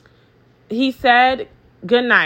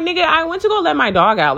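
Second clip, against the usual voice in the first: annoyed; 6 semitones higher, faster than usual. Retold or imitated. Retelling her annoyed reply.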